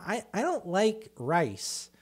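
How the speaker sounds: slow, high picky-eater whine; mock whining. Mimicking people who gripe about food, mock whiny. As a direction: drag it out like a fussy complainer. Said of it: "listening to somebody bitch about".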